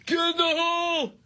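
high, stretched-out spectator holler; mock golf-fan yell. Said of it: "anybody that yells".

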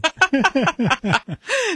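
quick high chuckle; high laugh. Real, not acted. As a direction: laugh at your own joke. Co-host laughs at own joke.

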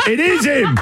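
high, hammed-up cry of recognition; mock exclamation. Receptionist recognising the hoax caller, in a hammed-up exclamation.

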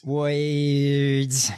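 WORDS drawn out as "woyds"; mocking, long-held "woyds" for WORDS; mock mispronunciation. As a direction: stretch WORDS with an "oy" vowel. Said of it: "they're not the words".

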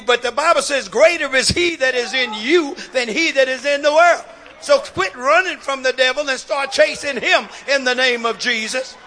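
loud, rousing pulpit voice; building, insistent sermon exhortation; forceful preaching. Real, not acted. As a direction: drive it home to the congregation.

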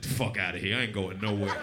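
low, soft dismissive voice; stubborn heat. Pretend. Heat answering a hurricane, stubborn and low. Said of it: "The heat's like".

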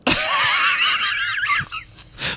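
long squealing laugh; cracking up at the Turkish prison taffy joke; high shrieking laugh. Real, not acted.

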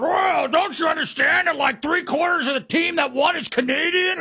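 high, whiny, shouting mock voice; whiny mock Canadian. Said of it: "And they come back out".

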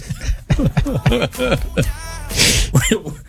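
long high laughing fit; high rolling laugh; high laughter. Real, not acted. Laughs at own joke.

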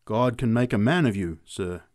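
ordinary delivery, nothing marked

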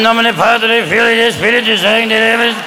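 aged pope intoning Latin; John Paul II. Acted. Comic imitates the old pope blessing, droned Latin. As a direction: drone the blessing almost on one note.

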